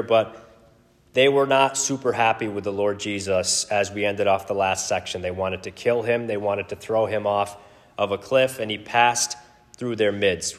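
ordinary talk

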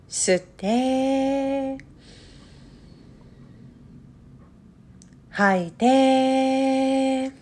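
drawn-out, hushed breath pacing; very slow breathing cues. Acted. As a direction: stretch each word like a long breath.

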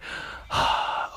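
long breathy nervous exhale; nervous sigh. Retold or imitated. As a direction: let out a sigh of nerves before going for it. Driver bracing before freestyling.

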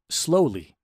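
plain reading voice

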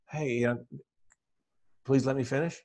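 gentle. Shown as a demonstration. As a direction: say "please let me finish" gently.

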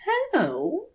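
a high, rising call into the woods; a high questioning hello. A narrator's reading. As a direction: call out hopefully to someone unseen. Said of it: "he calls".

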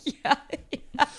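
high, laughing agreement. Real, not acted. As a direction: yeses breaking up in real laughter. Laughing at the impression.

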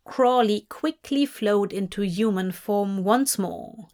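plain reading voice

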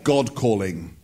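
lightly voiced mock-grand God; Charlton Heston as God. Preacher parodies God calling down to people, mildly mock-grand voice. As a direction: announce it as a film-epic God. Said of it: "God always speaks like Charlton Heston".

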